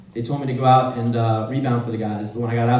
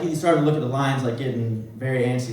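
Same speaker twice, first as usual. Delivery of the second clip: normal talking voice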